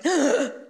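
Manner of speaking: short startled gasp; a sharp intake of breath, caught off guard. Retold or imitated. Called on by her father, a startled gasp.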